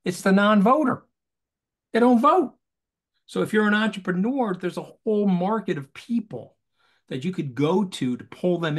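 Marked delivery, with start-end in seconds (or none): 1.9-2.5 high, loud punch on vote; They don't vote, with vote loud and high; emphatic punch. Real, not acted. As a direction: hit vote hard and high. Punchline of voting argument.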